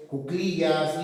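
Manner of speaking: ordinary talk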